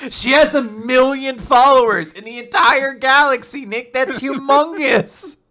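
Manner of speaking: high, loud incredulous outburst; hammed-up exasperation, stretched out at the end; mock outrage. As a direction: yell it in comic disbelief. Mock outburst arguing over the numbers.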